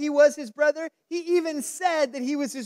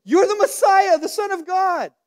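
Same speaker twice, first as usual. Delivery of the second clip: higher, louder, thrilled outburst; excited exclamation; 8 semitones higher, 7 dB louder than usual. Retold or imitated. Peter finally confessing Jesus, in a higher, louder, thrilled cry. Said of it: "for Peter to be like".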